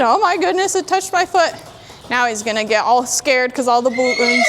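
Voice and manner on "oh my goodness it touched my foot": higher mock-startled horse voice; voicing the horse. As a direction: play the horse surprised by the ball. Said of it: "He said".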